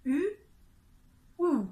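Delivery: "U" then "أو" with lips moving forward; U then OU contrast. Shown as a demonstration. Teacher showing students the U and OU difference. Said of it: "بصوا كده".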